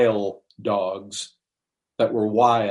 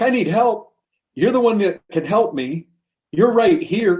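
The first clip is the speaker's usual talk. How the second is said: raised, emphatic retold plea; urgent appeal; 7 semitones higher, 4 dB louder than usual. Woman appeals to the Messiah right here, urgent. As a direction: press for help, pitched up.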